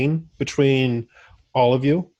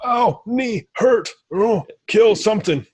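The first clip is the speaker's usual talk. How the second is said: high, slow-witted grunting brute; dim brute; 9 semitones higher, slower than usual. Acted. Game master voices the dumb injured orc as high, thick brute. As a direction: play him dim and pained, wanting to smash. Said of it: "He's just like".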